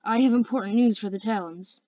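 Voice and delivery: plain reading voice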